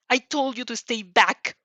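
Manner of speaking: stern scolding. Acted. Teacher scolds student.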